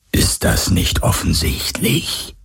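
slow, breathless whisper of a spirit; ghostly voice. Acted. The ghost voice taunts Galen, slow and whispered. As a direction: let it drift out like a ghost mocking him. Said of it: "fragte die Geisterstimme".

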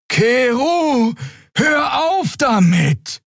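high, slow, drawn-out cry; alarmed. A narrator's reading. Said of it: "In Xeas träge Stimme mischte sich ein alarmiertes Tuten".

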